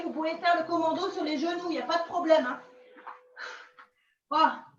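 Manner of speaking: ordinary talk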